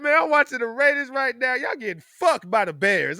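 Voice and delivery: a friend gloating about the Bears score; imitating gloating friend. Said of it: "He's like".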